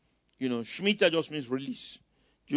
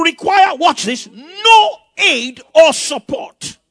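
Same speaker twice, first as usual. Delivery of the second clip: loud, higher, drawn-out shouted proclamation; preaching at full force; 8 semitones higher, 14 dB louder, slower than usual. Acted. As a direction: hammer each word from the pulpit. Preacher rereading scripture forcefully.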